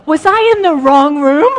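loud, high, laughing through the words; laughing disbelief. Retold or imitated. Preacher acting a baffled worship leader, laughing loudly. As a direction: crack up at your own confusion. Retold reaction to praised service.

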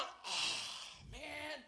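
soft, sighing letdown; disappointed groan. Acted. Archer told to stand down, sighing with disappointment. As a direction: let the air out in disappointment.

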